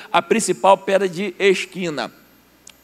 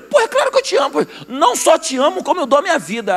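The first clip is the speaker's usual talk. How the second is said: squeaky high boast, voice cracking upward; eager high-pitched Peter; 8 semitones higher than usual. Acted. Peter swears his love to Jesus, high and eager. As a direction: blurt it out way up high, all bravado.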